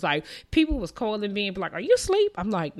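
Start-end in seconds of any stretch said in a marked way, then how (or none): 1.7-2.3 squeaky high, loud mock caller; high mock voice of a friend asking if she's asleep. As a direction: pitch way up like a puzzled friend on the phone.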